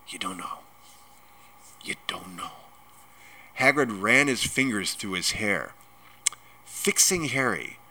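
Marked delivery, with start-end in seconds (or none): 0.1-2.5 near-whisper of disbelief; very soft, stunned murmur; stunned hush. A narrator's reading. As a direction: breathe it out, dumbfounded. Giant stunned by boy's ignorance.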